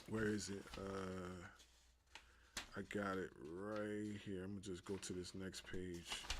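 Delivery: very soft and low, to yourself; low, quiet self-talk; absent muttering. Real, not acted. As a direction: murmur while hunting for your place. Host talks to himself, finding page.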